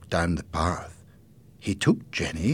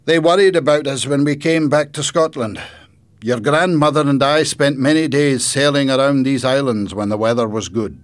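louder, higher grandfather voice; grandfather character; 8 semitones higher, 10 dB louder than usual. Acted. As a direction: project as an animated storybook grandfather. Grandfather recalls sailing days.

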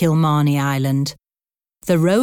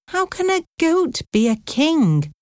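much higher, baffled question; confused; 11 semitones higher than usual. A narrator's reading. Brian asking how goats become kings, confused. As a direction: puzzle it out in a high voice. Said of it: "He was very confused".

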